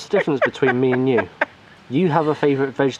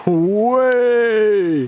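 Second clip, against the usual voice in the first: long, high, loud astonished cry; amazed at what he sees; delighted shout of surprise; surprised exclamation; 11 semitones higher, 5 dB louder than usual. Real, not acted. Seeing the emoji chocolates.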